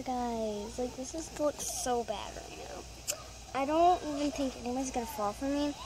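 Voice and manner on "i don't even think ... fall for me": rushed. Real, not acted. Quick nervous remark.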